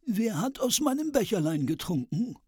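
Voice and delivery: high dwarf voice. A narrator's reading. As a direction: ask it as the last little dwarf. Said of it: "der siebte Zwerg".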